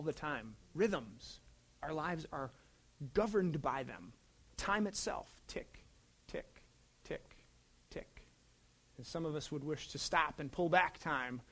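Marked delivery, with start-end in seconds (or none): 5.5-8.2 soft clock ticks. Retold or imitated. Imitating a clock ticking.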